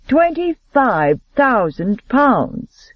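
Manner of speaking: slow, high lazy drawl. A narrator's reading. Gambler raising the stakes, drawled slowly. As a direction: stretch every word out lazily. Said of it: "he drawled".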